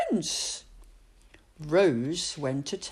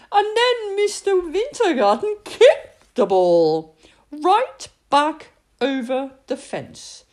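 ordinary delivery

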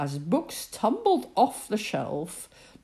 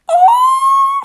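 very high, very loud startled shriek; queen shrieking as swans lift her; scream; 17 dB louder than usual. Acted. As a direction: shriek as she is lifted away. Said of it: "screamed the Queen".

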